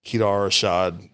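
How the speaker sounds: usual speaking voice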